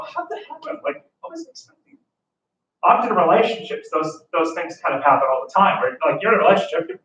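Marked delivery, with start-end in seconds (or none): 0.0-2.0 high, hushed mock disbelief; baffled afterthought. Teacher imitating someone after a fight, high and hushed. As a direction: ask it softly, pitched high, stunned. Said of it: "you look back at that argument and go".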